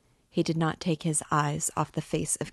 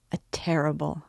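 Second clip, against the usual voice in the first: ordinary delivery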